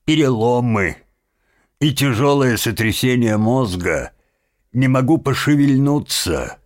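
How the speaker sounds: high, strained groaning complaint; feigned groaning. Acted. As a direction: pretend to be badly hurt, moaning. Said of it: "простонал Трёч". (притворно стонет)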